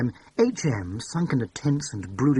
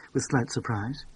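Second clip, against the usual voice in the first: ordinary delivery, nothing marked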